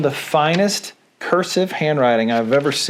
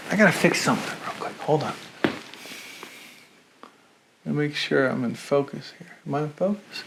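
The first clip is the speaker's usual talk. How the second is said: quick, softer muttering to himself while fiddling with the camera; muttered aside; 8 dB quieter, faster than usual. Real, not acted. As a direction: drop your voice and talk to yourself.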